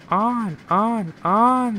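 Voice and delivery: high, loud drawn-out chant; yelling. A narrator's reading. Troublesome trucks egging the engine on, yelling. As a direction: yell each word long and high. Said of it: "they yelled".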